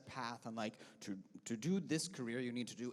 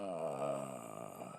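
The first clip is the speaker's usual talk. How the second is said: a low open yawn sound; 6 dB quieter than usual. Shown as a demonstration. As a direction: yawn down into the chest.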